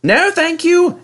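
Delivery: very high, proud refusal. A narrator's reading. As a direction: turn him down brightly, way up high. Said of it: "answered James".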